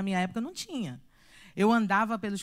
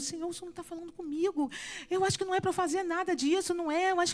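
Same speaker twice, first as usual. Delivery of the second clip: anxious prayer; 6 semitones higher, 4 dB quieter, faster than usual. Retold or imitated. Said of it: "aí eu comecei a orar".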